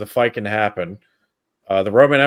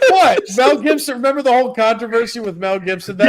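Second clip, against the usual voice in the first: much higher, incredulous; surprised; 11 semitones higher than usual. Real, not acted. Host startled by his friend's joke, high and incredulous. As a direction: bounce up in disbelief.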